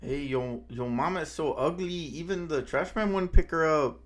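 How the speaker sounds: amateur Mexican-accent yo-mama joke. Shown as a demonstration. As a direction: put on a Mexican accent and belt out the punchline. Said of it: "could I do a Mexican accent".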